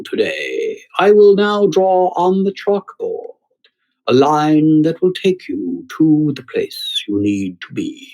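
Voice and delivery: put-on slow, pompous English accent, drawn-out lecture tone. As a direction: play a teen faking a grand English lecturer accent. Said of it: "without the accent".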